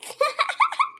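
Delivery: a sudden squealing laugh, rising high; high cackling laugh. Acted. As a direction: laugh at your own joke. Mother-in-law jokes about herself. (ऊँची ठहाकेदार हँसी)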